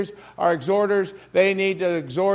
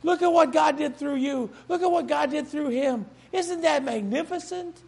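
higher, softer awed exclamations; awed praise; 7 semitones higher, 4 dB quieter than usual. Retold or imitated. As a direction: marvel at God's work. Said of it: "that people say".

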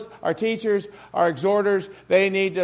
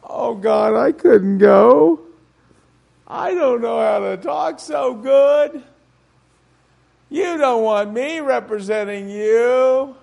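Moses pleading; 2 semitones higher, 4 dB louder, slower than usual. Retold or imitated. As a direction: whine like a reluctant prophet. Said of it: "Remember Moses?".

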